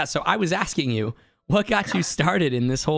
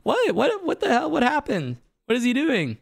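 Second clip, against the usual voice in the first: confused onlookers; 12 semitones higher than usual. Retold or imitated. Line reacts to his dance.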